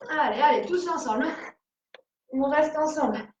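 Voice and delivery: strained, talking mid push up; coaching while doing push ups. Real, not acted. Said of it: "ça commence à chauffer dans les dernières pompes". (en pleines pompes, voix d'effort)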